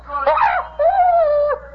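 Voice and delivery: high shrieking evil laugh; triumphant evil cackle; villain cackle. Acted. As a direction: cackle over the falling web.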